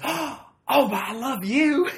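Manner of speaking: gasp, then a high, drawn-out squeal; hammed-up, very loud adoring cry; imitated fan shriek. Fan gasps and gushes at McCain.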